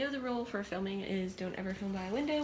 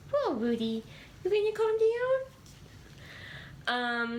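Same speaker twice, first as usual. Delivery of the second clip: talking to the dog; 12 semitones higher than usual. Real, not acted. Calming the excited dog.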